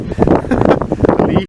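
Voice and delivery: breathy little laugh. Real, not acted. Laughs at the dog idea.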